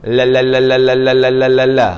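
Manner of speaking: "la" rattled off evenly on one note; tongue-tip lam drill. Shown as a demonstration. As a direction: repeat "la" off the tooth ridge. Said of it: "antara sempadan gigi dengan langit depan". (ulang "la" senada)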